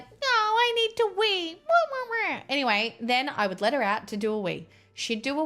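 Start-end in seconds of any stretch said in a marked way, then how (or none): 0.0-1.5 much higher, slow whiny dog voice; the dog's voice, high and pleading; voicing the dog. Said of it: "She'd be like". 1.7-2.4 high yappy whine; mock whine. Imitating dog whining.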